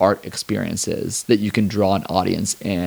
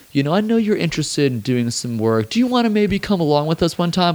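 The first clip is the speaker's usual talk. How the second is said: lightly voiced hesitant deputy, higher pitched; tentative inviter; 8 semitones higher than usual. Imitating a second in command offering work, tentative higher voice.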